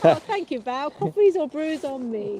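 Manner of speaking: high, loud, delighted thank you; excited thanks. Real, not acted. Thanking Val for coffee money, bright and loud.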